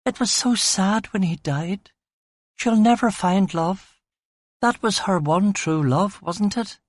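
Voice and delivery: high female voice, wistful; narrator's high, saddened woman; woman moved by sad film. A narrator's reading. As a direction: pitch it well up, lamenting a tragic romance.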